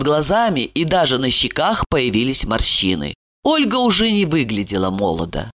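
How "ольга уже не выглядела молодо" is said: usual speaking voice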